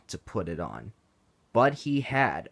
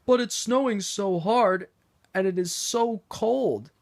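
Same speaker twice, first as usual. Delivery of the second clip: whiny, higher protest; reluctant Henry; 10 semitones higher, 4 dB louder than usual. Acted. Henry protesting to Sir Topham Hatt, mildly whiny. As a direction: complain to get out of it. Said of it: "said Henry".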